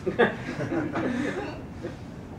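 short soft nervous laugh; a little embarrassed chuckle after the joke; nervous hedging thesis defense. Real, not acted. Joke about losing copepods.

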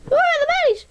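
very high squeaky question. Acted. Squeaky character asks.

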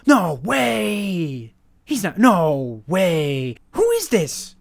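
stunned by a stranger's gift; amazed. Real, not acted.

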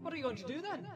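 small, very high, soft voice; high, light little hobbit voice; undaunted hobbit. Acted. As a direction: pipe up with a quiet question. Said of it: "undaunted by the wizard's bristling brows".

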